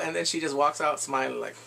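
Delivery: ordinary talk, nothing marked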